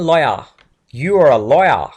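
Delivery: "lawyer" as "loy-ah", imitated British accent; English accent. Shown as a demonstration. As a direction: drop the final r in a British accent. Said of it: "accent में अगर इसको बोले".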